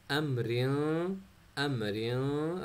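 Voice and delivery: अमरिन drawn out very slowly, n hidden in the nose; very slow nasal. Shown as a demonstration. Teacher slowly repeating अमरिन for students, hidden nasal n. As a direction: stretch अमरिन and hum its ending. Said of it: "proper नहीं पढ़ा".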